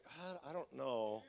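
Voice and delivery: very soft, slow, low reply; wary hesitation. Acted. Pastor unsure about the scary volunteer, hushed wary hesitation. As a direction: hedge it timidly under your voice.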